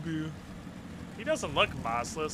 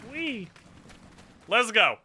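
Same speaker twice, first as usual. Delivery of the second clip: playful cheer; 6 semitones higher, 4 dB louder, slower than usual. Pretend. Playful cheer riding motorcycle.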